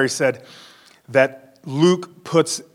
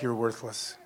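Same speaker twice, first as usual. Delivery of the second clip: a soft, much quieter taunting voice in your head; accusing inner voice; 4 semitones lower, 15 dB quieter than usual. Retold or imitated. Inner voice telling the believer he's worthless, soft and cold. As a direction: hush it down like a sneering thought. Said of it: "you'll hear things like".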